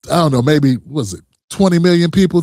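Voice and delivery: ordinary delivery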